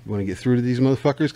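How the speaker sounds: ordinary delivery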